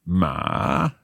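Ma dipping down then up, third tone. Shown as a demonstration. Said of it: "third tone is going down and up".

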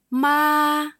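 ma high and level, first tone. Shown as a demonstration. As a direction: hold ma flat and high. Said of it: "first tone".

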